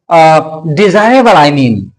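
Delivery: ordinary talk, nothing marked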